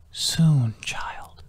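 airy whisper fading to almost nothing; whispering wind. A narrator's reading. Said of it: "the wind said to her thoughts".

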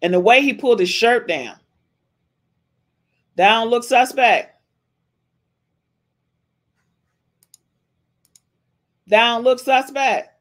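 mocking disbelief. Real, not acted. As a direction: rub in how suspect it looks. Ridiculing clip, repeated louder.

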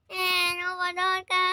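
put-on a sorry kid already crying before the punishment; tearful apology. Child apologizes to her mother while starting to cry. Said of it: "ยังไม่ได้ทันตีเลยร้องแล้ว".

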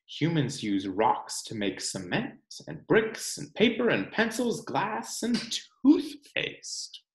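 put-on reading to children. Teacher reading the rock book to her class, storytime narration. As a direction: read it aloud for the class.

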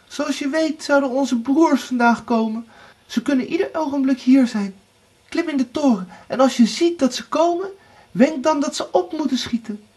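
high, hurried instructions; urgent wife. A narrator's reading. Erika tells Anna her plan, urgent and high. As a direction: press her along in a high voice.

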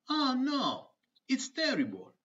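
mock dismay. Pupil groaning about science day in the dialogue. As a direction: play up the despair.

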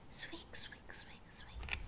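very soft, voiceless squeaks; whispered mouse squeaks. Acted. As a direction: whisper it like tiny mice. Mice squeaks, now hushed.

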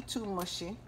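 normal talking voice

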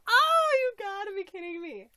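loud, high incredulous cry; thrilled disbelief. Real, not acted. Seeing the next gift, loud joyful disbelief.

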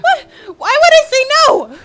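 high, loud, crying-edged outburst; upset outburst. Real, not acted. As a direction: blurt it out in high, loud disbelief. Upset shout at dog choice.

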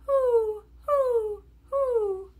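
high, falling hoots; owl hoots. A narrator's reading. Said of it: "He can sound like an owl".

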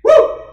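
very high, loud whoop; preacher's whoop. Real, not acted. Preacher whooping at the congregation, very high and loud. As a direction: let out a shout of joy.

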